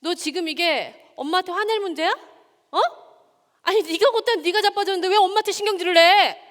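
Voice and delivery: firm at first, then building to a loud, high angry scold; scolding her son. Retold or imitated. Mother reenacting how she scolded her crying son, building from stern to a loud rebuke. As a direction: start stern, then blow up at the child. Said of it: "이렇게 얘기했습니다".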